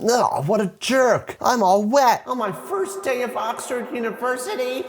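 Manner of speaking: high-pitched, exaggerated whine; whining film heroine. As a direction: wail it in a mock girlish voice. Acts out drenched film heroine complaining.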